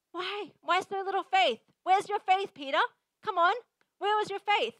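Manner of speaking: high, loud mock scolding; harsh rebuke. Preacher imitates Jesus scolding Peter, harsh rebuke. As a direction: demand an answer, exasperated and arrogant. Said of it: "That's pretty harsh".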